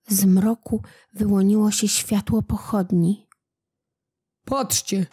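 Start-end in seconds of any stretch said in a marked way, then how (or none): 4.4-5.0 soldier shouts. A narrator's reading. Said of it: "krzyknął któryś z żołnierzy".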